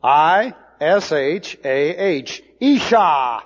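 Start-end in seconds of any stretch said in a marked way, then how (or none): 2.6-3.4 Isha spoken as EE-shah, stress on the last syllable; Hebrew word said aloud. Shown as a demonstration. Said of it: "for woman looks like this".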